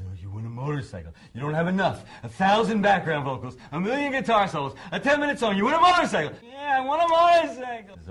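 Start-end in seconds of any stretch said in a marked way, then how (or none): 0.0-6.4 building from plain to a much higher, louder rant; mock exasperated shout. [name] ranting back at Steinman, mock exasperated shouting. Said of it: "and he says". 6.4-8.0 put-on much higher, louder whiny child insisting; whining kid. Steinman insisting to [name] like a child, whiny kid voice. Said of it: "the really annoying, whining kid".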